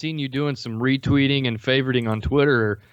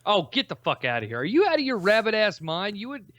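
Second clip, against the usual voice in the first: high, disbelieving outburst; can't believe what he hears; incredulous; 7 semitones higher than usual. Real, not acted.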